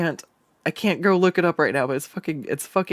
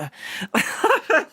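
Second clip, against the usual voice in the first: high cackle; 14 semitones higher than usual. Pretend. After villain bit.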